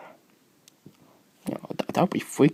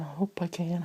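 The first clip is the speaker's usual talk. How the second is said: whispered repeat; 4 semitones higher, 8 dB quieter than usual. Real, not acted.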